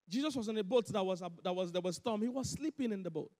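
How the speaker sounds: ordinary delivery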